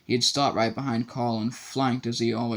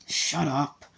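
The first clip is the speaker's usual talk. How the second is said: snapped; 3 semitones higher than usual. A narrator's reading. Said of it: "snapped Harry".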